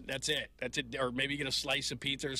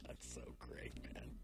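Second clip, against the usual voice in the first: breathless whispered aside; 16 dB quieter than usual. Real, not acted. Host whispering to himself after the caller's song. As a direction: whisper it in delight under your breath.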